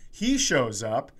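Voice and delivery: normal talking voice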